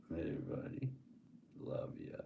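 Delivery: tender whispered aside; soft whispered affection. Real, not acted. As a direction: breathe it to the statue. Whispering to knight statue.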